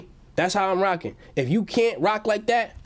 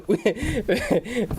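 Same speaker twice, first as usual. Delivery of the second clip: high real laughter; laughing; 5 semitones higher than usual. Real, not acted. Laughing at the chat.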